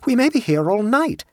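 high-pitched dismayed exclamation; high, alarmed traveller; exclaiming. A narrator's reading. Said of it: "exclaimed Abbleway".

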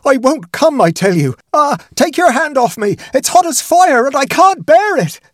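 panicked high-pitched scream of refusal; terrified scream. A narrator's reading. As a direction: shriek it out as if burned by a ghost's touch. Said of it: "it rose to a scream as it spoke".

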